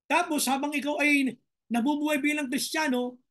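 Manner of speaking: usual speaking voice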